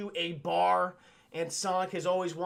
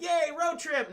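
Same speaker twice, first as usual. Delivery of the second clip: put-on higher, bouncy excited kid voice; happy cheer; 9 semitones higher, 3 dB louder, slower than usual. Sonic celebrating the road trip, high and excited. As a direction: sing it out like a kid on holiday. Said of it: "Sonic's like".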